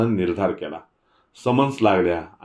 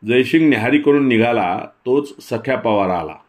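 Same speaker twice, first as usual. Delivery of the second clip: plain reading voice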